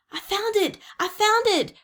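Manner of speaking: high, loud cry of discovery; excited cry. A narrator's reading. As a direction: call out in triumph, twice over. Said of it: "cried Jack".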